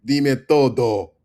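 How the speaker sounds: loud, slow, dumb caveman. Pretend. Teacher mocks a caveman saying todo. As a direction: lumber it out like a caveman. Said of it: "Goddamn caveman".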